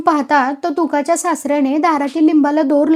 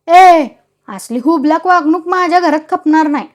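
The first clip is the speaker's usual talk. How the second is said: loud, higher angry snarl at the wife; angry growl; 4 semitones higher, 6 dB louder than usual. A narrator's reading. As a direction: growl it out, furious at her. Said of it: "तुका डोळे वटारून गुरगुरला". (रागाने गुरगुरत ओरडणे)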